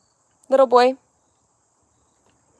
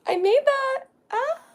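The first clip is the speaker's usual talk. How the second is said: squeaky voice; 4 dB louder than usual. Real, not acted. Playful squeak over painting.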